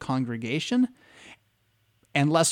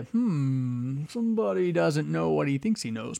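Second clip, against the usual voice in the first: knowing mock suspicion; 3 dB quieter, slower than usual. Mocking hint about the caller.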